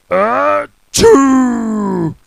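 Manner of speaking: loud booming sneeze; huge sneeze. Acted. Mister Noisy sneezing, loud booming sneeze. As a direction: sneeze loud enough for the next country.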